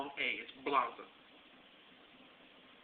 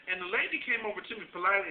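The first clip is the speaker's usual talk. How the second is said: ordinary delivery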